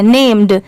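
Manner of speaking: "नेम्ड" with a clear d after the m; final d sounded. Shown as a demonstration. Teacher showing students the d at the end. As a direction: close "नेम्ड" on a crisp d. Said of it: "इसको नेम्ड पढ़ते हैं".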